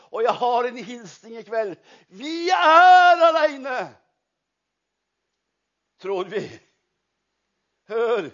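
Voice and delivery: usual speaking voice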